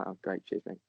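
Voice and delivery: deadpan the thanks under his breath; sarcastic thanks. Retold or imitated. [name]'s mock reply to the insult, quiet deadpan sarcasm. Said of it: "I was like".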